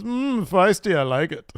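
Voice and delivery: high, purring mock delight; mock-seductive heel. Fan voicing Ludwig enjoying Maxine's slap, mock-flirty. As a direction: relish the slap, hammed-up. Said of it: "And he says".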